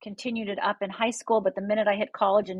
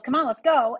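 raised, higher retold call; mock yell; 5 semitones higher, 5 dB louder than usual. Dad hurrying his daughters out to the car, mock yell. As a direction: call out impatiently, a little louder and higher. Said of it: "he yells".

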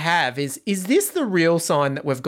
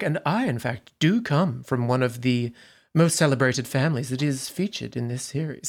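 imitating Baranski; 5 semitones lower, 4 dB quieter than usual. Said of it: "one interview where she's like".

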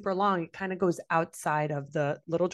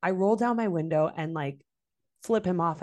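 ordinary talk

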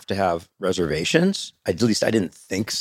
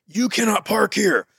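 high, slow, emphatic scolding; mock yelling; 10 semitones higher than usual. Gate guard busting the driver, high mock yell. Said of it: "yell at me and bust me".

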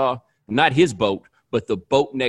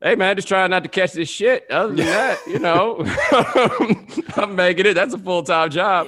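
laughing; 5 semitones higher than usual. Real, not acted. Laughs through his greeting.

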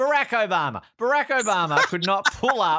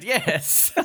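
normal talking voice

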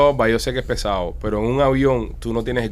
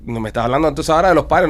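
ordinary delivery

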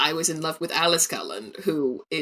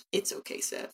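whispered; 13 dB quieter than usual. Real, not acted. Whispering to the dog.

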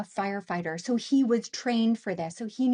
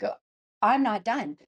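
defiant refusal; 5 dB louder than usual. Retold or imitated. Defiant answer to higher self.

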